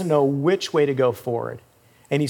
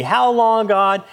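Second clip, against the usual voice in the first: complaining plea; 5 semitones higher, 4 dB louder, slower than usual. Acted. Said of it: "starts complaining".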